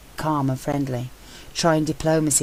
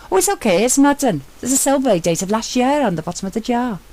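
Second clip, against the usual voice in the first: high, louder voice calling up from below; an eager explorer calling up from a hidden chapel; a higher voice for Sentry, reporting a find; Sentry's excited voice; 9 semitones higher, 5 dB louder than usual. A narrator's reading. Sentry relieved.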